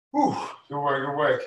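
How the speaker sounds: catching breath between words. Real, not acted. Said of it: "Get your breath, get your breath".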